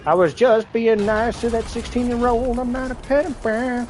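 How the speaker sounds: high, breathy mocking girl voice; imitating her. Mocking the High Sierra girl's excuse, high. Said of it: "that High Sierra chick who's like".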